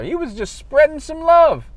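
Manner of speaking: a light, high imitation of the amused old guard; imitating old security guard. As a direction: play the old guard chuckling to his colleague.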